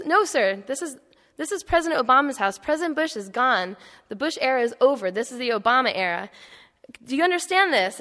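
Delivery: a Marine losing his patience at last; drilling it in with a mock-stern snap; exasperated Marine. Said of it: "the Marine had kind of lost it".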